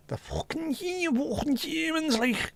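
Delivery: very high, screeching Scouse caricature; drawn-out Scouse squeal like a coffee machine; mock Scouse accent. Showing how Scousers sound, squealed mock Scouse. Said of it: "I reckon we sound like coffee machines".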